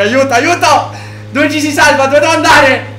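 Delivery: high, loud desperate questions; panicked shouting. Real, not acted. Fleeing player asking friend for the exit, panicked shouting. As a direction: yell for help and directions. Fleeing, asks where safety is.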